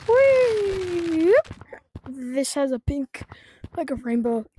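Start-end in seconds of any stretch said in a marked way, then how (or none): 0.1-1.4 excited whee. Real, not acted. Sliding down in game.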